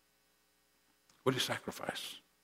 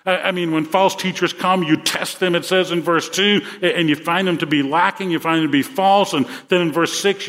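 preaching that climbs higher and louder; rising sermon fervor; 5 semitones higher, 6 dB louder than usual. Acted. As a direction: build the exhortation up to a rousing peak. Preaching builds to full force.

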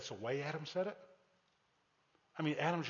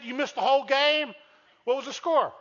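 mock surprise; 10 semitones higher, 12 dB louder than usual. Asking about missed game.

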